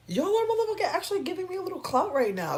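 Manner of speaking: high-pitched hyped gloating; excited. Real, not acted. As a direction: crow about the clout in a high voice. Hyped up, trolls adding to streams.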